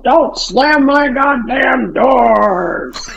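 put-on furious dad yelling at slamming teenager. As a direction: bellow it like a furious father. Dad yelling at teen daughter.

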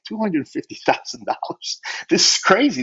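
half-laughing disbelief, pitch up; mock-stunned, laughing at the money; retold disbelief. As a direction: marvel at the huge sum, then laugh it off. Retelling shock at huge payment.

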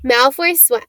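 ordinary delivery

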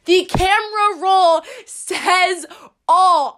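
CAMERA ROLL drawn out, high-pitched; slow, punched "camera roll says all"; emphatic proclamation. Real, not acted. Emphatic announcement to her viewers.

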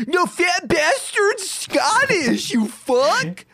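high mock outraged reviewer voice; mock angry reviewer. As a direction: squeal it like a furious online troll. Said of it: "someone would give us a one-star review and be like".

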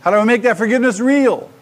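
high, forceful cry of a question; loud, high demand for an answer; loud acted questioning. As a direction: call it out as a struggling listener. Voices the listeners' question loudly.

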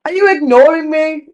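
mild mock shout; imitated scolding shout. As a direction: challenge him like an ignored grandma. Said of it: "And she shouts it".

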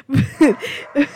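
amused burst of laughter; helpless giggle at an interruption. Real, not acted.

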